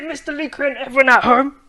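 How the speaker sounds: usual speaking voice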